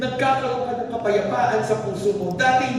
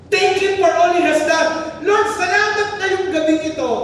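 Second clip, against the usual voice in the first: preaching at full force; 8 semitones higher than usual. Real, not acted. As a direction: lift the room, pour it out.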